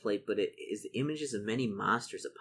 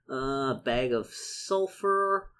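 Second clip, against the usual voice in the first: normal talking voice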